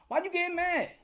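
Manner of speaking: usual speaking voice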